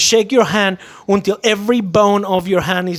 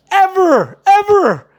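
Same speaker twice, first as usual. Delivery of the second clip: ever ever pushed high and loud; stress on ever; 11 semitones higher, 4 dB louder, slower than usual. Real, not acted. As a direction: punch each ever. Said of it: "emphatic warning".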